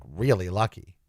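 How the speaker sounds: ordinary talk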